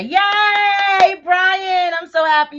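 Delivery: high, loud cheer for a viewer; happy squeal of welcome. Real, not acted. Cheering a viewer's first text alert.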